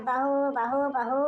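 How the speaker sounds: daughter-in-law parrots the old woman's बहू बहू mockingly; mocking imitation. Daughter-in-law mimics mother-in-law's calling.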